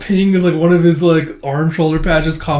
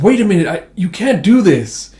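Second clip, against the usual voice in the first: agitated protest; 6 semitones higher, 5 dB louder than usual. Retold or imitated. Rejected Mark protests to priest.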